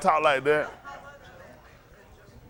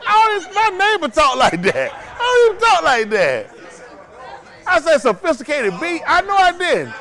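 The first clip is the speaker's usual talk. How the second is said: high, loud, building outburst; worked-up, incredulous objection climbing to a shout; indignant protest; 10 semitones higher, 5 dB louder than usual. Real, not acted. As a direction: deny it loudly, pitched way up. Host disputes a misquote.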